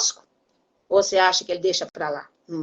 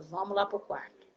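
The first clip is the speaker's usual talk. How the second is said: quiet stern summons; 13 dB quieter than usual. Retold or imitated. Mother quietly summons her naughty son to the bedroom. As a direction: call him aside quietly, no fuss.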